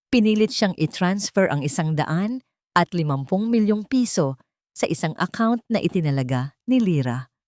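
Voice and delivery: usual speaking voice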